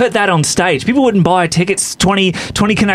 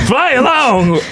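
high baffled echo; 9 semitones higher, slower than usual. Acted. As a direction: squeak out the disbelief. Whoopi baffled by flying ghost.

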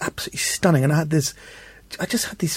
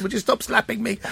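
much higher, exasperated stop-it plea; 8 semitones higher, 3 dB quieter than usual. Retold or imitated. Driver begging the slapping kids, high exasperated.

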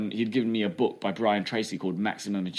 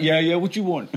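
cocky comeback; 6 semitones higher, 4 dB louder than usual. Retold or imitated. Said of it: "And I was like".

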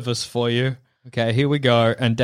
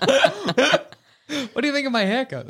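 mock eager friend; 10 semitones higher, slower than usual.

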